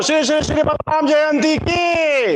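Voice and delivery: high, ringing acclamation; leading a cheer. Real, not acted. Leader hails Balaram Jayanti to the crowd, high rallying call.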